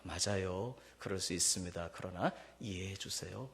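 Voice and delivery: gentle respectful. Shown as a demonstration. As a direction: answer the partner kindly and humbly. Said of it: "이것이 아니라".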